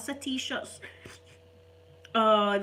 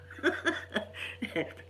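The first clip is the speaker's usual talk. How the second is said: laugh; 2 semitones higher than usual. Real, not acted. Laughing at baby frowning.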